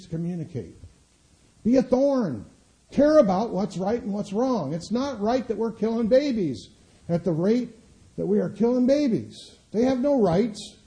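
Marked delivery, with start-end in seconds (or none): 1.6-10.8 Be a thorn. thrown out much higher and louder; climactic rallying cry. Acted. Preacher urging congregation to resist officials, much higher and louder. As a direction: raise it to a crescendo. Repeated exhortation, now climactic.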